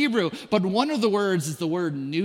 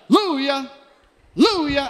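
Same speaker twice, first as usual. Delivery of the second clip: high, loud mock shout of praise; retold scream; 6 semitones higher, 6 dB louder, faster than usual. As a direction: belt out hallelujah like a joyful friend. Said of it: "You're screaming".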